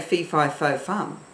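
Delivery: normal talking voice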